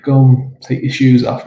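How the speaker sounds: ordinary talk, nothing marked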